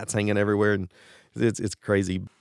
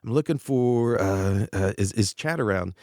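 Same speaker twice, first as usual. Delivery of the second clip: hesitant, drawn-out ums; absent-minded, searching for the words; distracted; slower than usual. Singer phoning a guitarist with his mind elsewhere, distracted. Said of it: "he's distracted about something".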